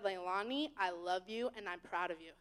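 low, soft voice of gentle affirmation; tender reassurance. Retold or imitated. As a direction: reassure her lovingly, low and quiet. Voicing God's tender reassurance.

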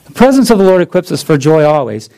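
fast, run-together restatement of the point; quick recap. Shown as a demonstration. Preacher rattling off his main point fast. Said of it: "let me do it this way".

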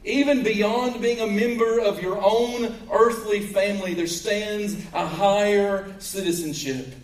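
high-pitched, earnest pulpit voice; emphatic preaching. Real, not acted. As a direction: preach it with conviction.

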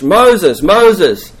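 high, loud summons; calling out. Acted. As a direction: call the name out, high and strong. God calls from burning bush.

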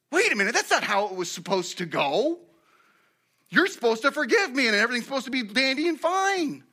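high, whiny objection; mock indignant protest. Confessor to Elijah who won't forgive, mock indignant protest. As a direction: complain as if cheated of forgiveness.

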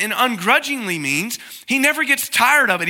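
normal talking voice

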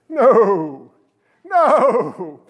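NO loud and drawn out; emphatic denial. Acted. Preacher answering whether we overthrow the law, loud drawn-out NO. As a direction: boom a long, falling "no".

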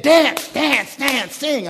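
barked, high, loud dance commands; mock-furious teacher ordering kids around; mean teacher shouting. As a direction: yell it like a tyrant choir teacher. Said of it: "mean lady who said".

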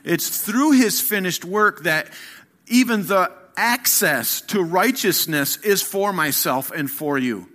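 rousing sermon delivery; full-force preaching. Acted. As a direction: build it like a pulpit crescendo.

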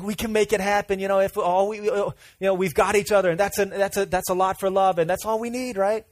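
a lightly voiced desperate girlfriend; pleading girlfriend. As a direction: beg him to stay together. Girlfriend pleading to stay together.

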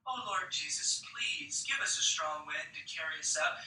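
soft, gentle imitated prayer; delicate prayer. Recalling her first delicate prayer, said softly. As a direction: murmur it sweetly and softly. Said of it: "a far cry from".

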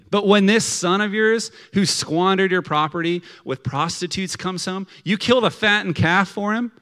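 acted-out indignant outburst at a father; mad older brother. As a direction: let years of resentment pour out. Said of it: "This is how mad the older brother is".

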